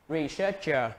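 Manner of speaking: usual speaking voice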